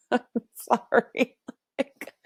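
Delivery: apology broken up by laughter; laughing. Real, not acted. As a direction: try to talk through a real laugh. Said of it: "I started laughing".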